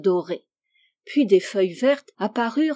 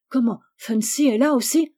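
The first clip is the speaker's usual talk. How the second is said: higher-pitched surprised question; surprised exclamation; 4 semitones higher, 4 dB louder than usual. A narrator's reading. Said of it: "s'écria Rose". (exclamation de surprise)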